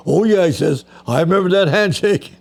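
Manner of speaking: delighted recognition. Retold or imitated. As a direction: remember it all at once, beaming. Star recognizes the strong handshake.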